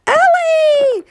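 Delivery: high, loud crowd cry of her name; villagers crying out. Acted. Villagers cry out to the giant Ellie, loud and high. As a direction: call out her name in alarm. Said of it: "cried the villagers".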